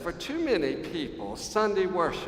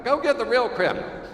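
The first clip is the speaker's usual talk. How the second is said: loud, higher mock retort; indignant driver; 8 semitones higher, 9 dB louder than usual. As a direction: fling it out like an offended driver. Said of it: "That's what I hear".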